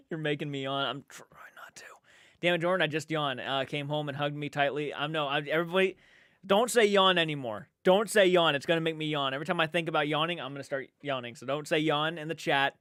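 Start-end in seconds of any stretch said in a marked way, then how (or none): 0.1-1.8 breathless whisper through a stifled yawn. Real, not acted. Fighting a contagious yawn. 6.5-8.6 louder, higher mock-pleading to the chat; pleading call-out. Real, not acted. Begging chat to stop yawns.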